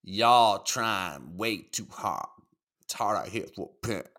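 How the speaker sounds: a slowed, put-on Southern accent. Reading a viewer's chat message aloud to his friend, in a put-on Southern accent. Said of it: "in an accent?".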